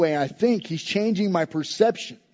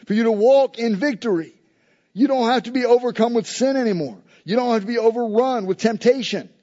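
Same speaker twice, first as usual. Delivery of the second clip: raised-pitch closing exhortation; fervent preaching; 4 semitones higher, 3 dB louder than usual. Acted. Pastor calling the church to live in victory, fervent exhortation. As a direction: drive the call home with conviction.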